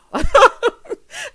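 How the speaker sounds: loud burst of high laughter. Real, not acted. Vlogger cracking up at her own joke, loud laugh. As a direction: cackle at your own joke.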